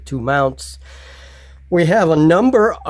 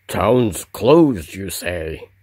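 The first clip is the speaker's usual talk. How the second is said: confused; 6 semitones lower, much slower than usual. Acted. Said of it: "with a confused tone".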